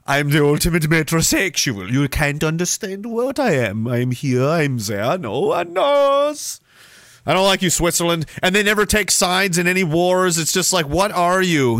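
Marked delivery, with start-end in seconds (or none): 0.0-6.6 hammed-up Swiss accent, drawn out; mock Swiss accent. Host playing Switzerland as an aloof metrosexual, mock Swiss accent. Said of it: "How do you do a Swiss accent?".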